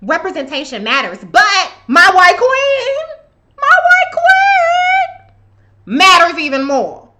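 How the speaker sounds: high loud parody of a fawning fan; mocking rewrite. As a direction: ham up the fawning, stretch it out. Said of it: "Y'all sound real crazy".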